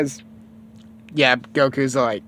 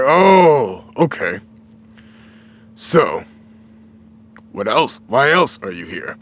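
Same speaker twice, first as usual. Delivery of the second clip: Ox King; 5 dB louder, slower than usual. Retold or imitated. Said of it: "He's like".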